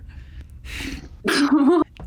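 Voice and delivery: evil laugh. Acted. Villain Ash laughing.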